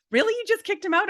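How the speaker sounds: high, incredulous question; stunned friend asking; friends' disbelief. Retold or imitated. Friends asking the storyteller in high disbelief.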